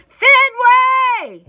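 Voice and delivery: full-force, high, drawn-out yell; very loud playful scolding; mock-angry scream. A narrator's reading. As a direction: scream the name, pretending to be mad. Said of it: "screams even louder, pretending to be mad and growly".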